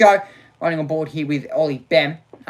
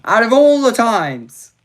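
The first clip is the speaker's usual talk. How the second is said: louder, higher frustrated complaint; exasperated; 8 semitones higher, 10 dB louder than usual. Real, not acted. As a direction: vent it at the screen.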